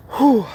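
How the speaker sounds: loud breathy whew after a climb; relieved whew. Real, not acted. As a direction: blow out a tired whew at the hilltop. Coming to the top of the hill.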